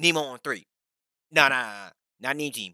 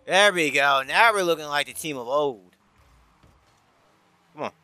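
ordinary talk, nothing marked

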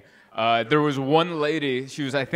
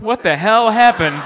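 louder, laughing, higher "What the hell happened?" with a falling end; exasperated laughing yell; 6 semitones higher, 8 dB louder than usual. Pretend. Comic future self losing it, laughing near-shout. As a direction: throw up your hands and half-shout it through a laugh. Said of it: "I would be like".

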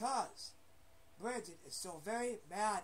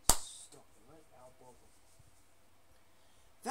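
lower, whispered stop it, slowing; whispered scolding; 6 semitones lower, much slower than usual. Pretend. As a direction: hiss the warning slowly.

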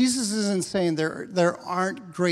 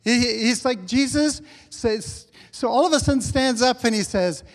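usual speaking voice

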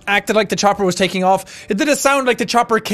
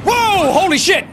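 louder, high startled outburst; 9 semitones higher, 4 dB louder than usual. Real, not acted. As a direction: jump at the scare. Startled by jump scare.

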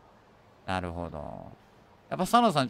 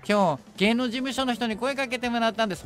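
excited news; 9 semitones higher than usual. Acted. Young singer reporting she was scouted, acted excitement. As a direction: announce it brightly in character.

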